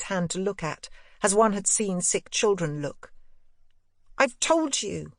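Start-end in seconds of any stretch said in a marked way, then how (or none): 4.2-5.1 put-on high boyish voice, rising insistence; a boy pushing back; boy Miles. A narrator's reading. Boy repeating himself to his governess, high and insistent.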